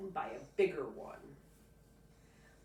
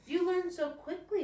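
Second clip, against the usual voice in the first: much higher, louder proud mother voice; a proud mom, much higher and louder; mom's praise; 13 semitones higher, 9 dB louder than usual. Acted. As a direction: praise the boy brightly. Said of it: "Mom says".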